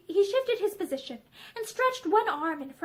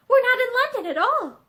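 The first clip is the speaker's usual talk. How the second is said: higher, louder, sharply amazed; sharp amazement; 6 semitones higher, 7 dB louder than usual. A narrator's reading. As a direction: realise it with a jolt. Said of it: "she said in sharp amazement".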